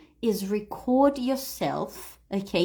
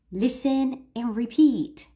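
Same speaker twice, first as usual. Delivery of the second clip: ordinary talk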